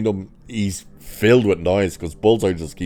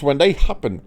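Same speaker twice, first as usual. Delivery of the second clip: ordinary talk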